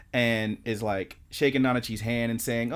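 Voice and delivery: ordinary talk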